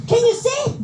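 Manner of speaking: high-pitched mimic of the employer; boss questioning. (mataas na gaya ng amo)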